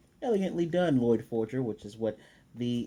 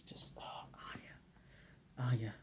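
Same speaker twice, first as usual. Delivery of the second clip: adoring whisper; 14 dB quieter, slower than usual. Real, not acted. Gushing over cute mispronunciation.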